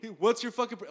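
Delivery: loud, high retold demand; heated. Imitating John getting in his face, loud and heated. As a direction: bark it at him. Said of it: "Sounds a little heated".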